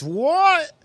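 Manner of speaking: sudden very high squeak of disbelief; shocked what. Real, not acted. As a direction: shoot up in pitch, stunned. Shocked at TV prices.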